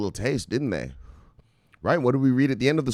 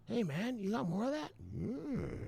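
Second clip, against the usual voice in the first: high, soft, eager voice of a hooked user; mock addict; 11 semitones higher, 14 dB quieter than usual. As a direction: ask for more in a small high voice. Addict begging dealer for more.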